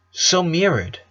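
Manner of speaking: ordinary delivery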